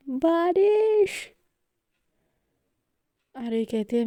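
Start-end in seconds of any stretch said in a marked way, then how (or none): 0.1-1.3 high, drawn-out joyful shout. A narrator's reading. Mehar shouts happily as the rain starts. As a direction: call out the word with delight. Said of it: "चिल्ला के कहती है".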